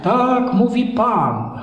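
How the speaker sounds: slow, drawn-out prophetic proclamation; mock street prophet. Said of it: "zaczniesz robić tak".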